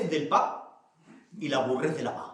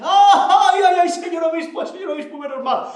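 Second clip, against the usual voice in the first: high, loud cackling cry tumbling down in pitch; laughing Manolona; 11 semitones higher, 7 dB louder than usual. Acted. Manolona comes downstairs laughing to the bishop, laughing high and loud. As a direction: act out laughing relief, very high. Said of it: "baja Manolona riéndose".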